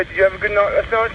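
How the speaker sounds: ordinary talk, nothing marked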